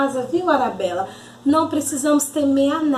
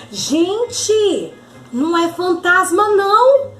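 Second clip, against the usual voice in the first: storybook shout; 5 semitones higher than usual. Acted. A disciple announces Jesus on the water, excited shout. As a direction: call it out to the whole boat. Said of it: "alguém gritou bem assim".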